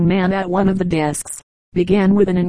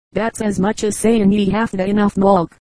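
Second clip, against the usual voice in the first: ordinary delivery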